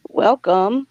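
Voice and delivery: ordinary talk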